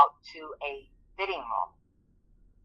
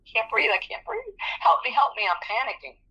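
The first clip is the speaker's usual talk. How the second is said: high, breathless pleading to the Lord; panicked prayer; 7 semitones higher than usual. Retold or imitated. Alone on a fitting-room bench, praying in panic. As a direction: beg for help, rising in panic. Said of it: "like, praying".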